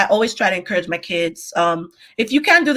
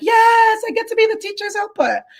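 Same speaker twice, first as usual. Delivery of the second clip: put-on much higher, bright eager child squeal; eager kid voice; 11 semitones higher than usual. Teacher imitating an eager pupil, in a high kid voice. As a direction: play the thrilled kid who gets the chair. Kids quoted loving the chair.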